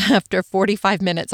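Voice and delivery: usual speaking voice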